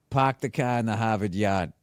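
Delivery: dropped-R Boston accent. Shown as a demonstration. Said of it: "one thing that they always say".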